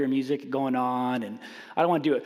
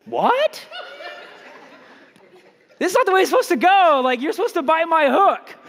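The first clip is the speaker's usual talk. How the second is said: much higher, louder, fast, laughing disbelief; stunned disbelief; 13 semitones higher, 8 dB louder than usual. Retold or imitated. Caller stunned when the rep lets him go, disbelief. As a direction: blurt out shocked that the plan failed. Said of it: "I didn't know how to respond".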